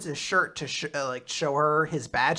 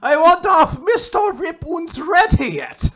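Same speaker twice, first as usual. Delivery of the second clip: loud, high, drawn-out mock German; terrible German accent; 12 semitones higher, 11 dB louder, slower than usual. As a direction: bellow it in a badly faked German accent. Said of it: "Just the worst German".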